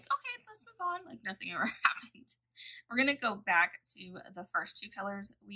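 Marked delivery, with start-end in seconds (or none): 0.0-1.0 breezy mock cheer. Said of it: "I'm all like".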